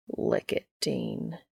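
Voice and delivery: low, breathy sultry murmur; half-whispered, seductive; sultry whisper from the teasing angel. A narrator's reading. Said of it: "he says in a fucking sultry voice".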